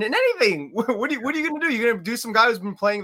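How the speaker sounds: incredulous. Real, not acted. Incredulous rant about keeping backup.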